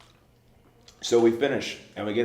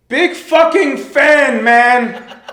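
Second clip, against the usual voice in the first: huge drawn-out scream, much higher and louder; 18 dB louder, much slower than usual. Retold or imitated. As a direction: scream it as loud as you can. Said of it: "he screams it as loud as he can".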